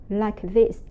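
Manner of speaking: ordinary talk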